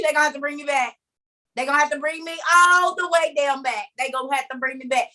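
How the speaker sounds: usual speaking voice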